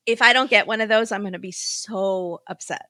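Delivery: ordinary talk, nothing marked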